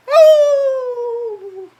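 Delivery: very high, loud howl; boy howling at the moon, high and loud. A narrator's reading. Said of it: "he howled at the moon".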